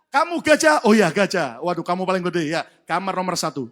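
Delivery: loud, amazed welcome to a huge arrival; excited calling out. Acted. Noah assigning the elephant a room, excited calling out.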